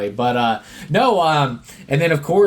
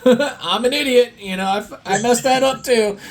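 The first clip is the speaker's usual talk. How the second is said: much higher, self-mocking put-on voice; imitating Dylan O'Brien; 10 semitones higher than usual. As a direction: play a sheepish guy calling himself dumb, pitched way up. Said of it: "so Dylan O'Brien in this scene".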